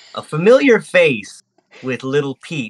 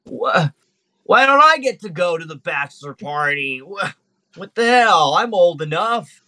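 higher, indignant protest of being left out; whiny young Cain; 5 semitones higher than usual. Acted. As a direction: whine it like a kid who can't come along.

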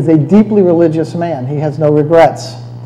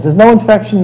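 usual speaking voice

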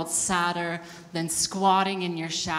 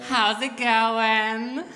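"going" stretched long and rising high; high, stretched-out stage hello; drawn-out greeting; 5 semitones higher, 5 dB louder, much slower than usual. Acted. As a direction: greet the crowd very slowly, lifting at the end. Exaggerated greeting, then redone more normally.

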